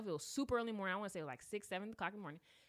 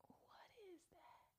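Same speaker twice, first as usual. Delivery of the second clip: breathless whispered question; slow scared whisper; 9 semitones higher, 29 dB quieter, slower than usual. Retold or imitated. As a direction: hush it like you're spooked. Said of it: "And I was like".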